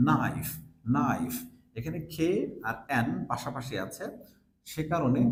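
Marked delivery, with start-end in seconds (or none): 0.1-1.3 নাইফ said with no k sound; teacher models নাইফ, silent k; knife with silent k. Shown as a demonstration. Teacher to students: নাইফ with no k sound. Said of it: "যেরকম আমরা বলছি".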